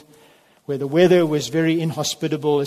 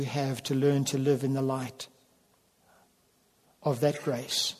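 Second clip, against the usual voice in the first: closing prayer. Acted.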